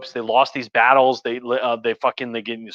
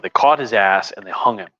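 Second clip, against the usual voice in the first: ordinary delivery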